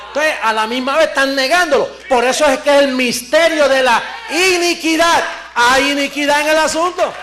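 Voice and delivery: fiery preacher warning about hidden iniquity; full-force preaching. Acted. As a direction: hammer the point home to the congregation.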